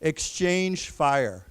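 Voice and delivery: very slow, each syllable clear; slow repeat-after-me. Shown as a demonstration. Teacher leading class repetition, slow and clear. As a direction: model the phrase slowly for the class. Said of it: "So let's say that together".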